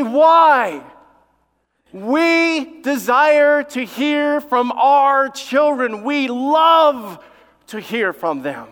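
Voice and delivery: high, loud, very slow proclamation; full-force preaching. Real, not acted. Preacher answering why parents treasure cards, full-force preaching. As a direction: proclaim it with stretched words.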